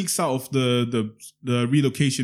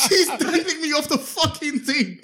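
laughing incredulous retort; 13 semitones higher than usual. Retold or imitated. Imitating the pranked man's reply.

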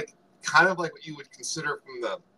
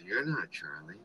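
lower, softer, mock disappointed "You're not Charlie"; a lightly voiced let-down sigh of a line; Oh my God voice; 6 semitones lower, 8 dB quieter than usual. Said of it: "in that, "Oh my God" voice".

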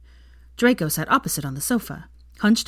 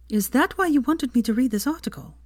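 curiously; 8 semitones higher than usual. Acted. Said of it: "she asked curiously".

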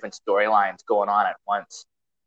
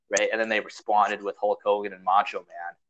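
usual speaking voice

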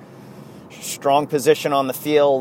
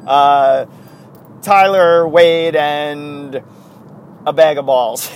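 imitating Derek Jeter; 4 semitones higher, 6 dB louder, slower than usual. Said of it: "Derek Jeter calls Brian Cashman and is like".

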